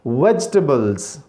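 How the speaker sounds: "वेजिटेबल्स" slowly as vej-tuh-bulz; correct pronunciation. Shown as a demonstration. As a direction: draw out "वेजिटेबल्स" in three syllables, falling. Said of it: "इसे कहते हैं वेजिटेबल्स".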